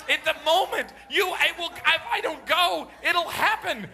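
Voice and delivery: put-on high, loud frantic kid voice; a stalling son begging for the bathroom; urgent child. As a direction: plead like a boy who must run. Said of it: "I'm serious".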